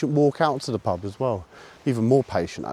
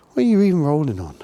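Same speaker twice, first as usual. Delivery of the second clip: quick, louder incredulous question; baffled at the dog; mock-exasperated at the dog; 6 semitones higher, 4 dB louder, faster than usual. Real, not acted.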